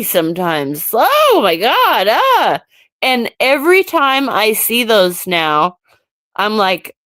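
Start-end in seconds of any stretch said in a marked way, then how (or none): 0.9-2.6 high-pitched playful wail of horror; mock horror. Reacting to scary photos of herself, mock horror. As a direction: recoil dramatically, pitch swooping up.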